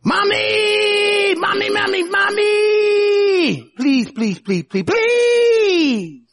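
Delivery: put-on high, drawn-out wail of a begging kid; whiny, stretched-out mock tantrum plea; screaming, pleading child. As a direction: imitate a child shrieking and begging for mommy.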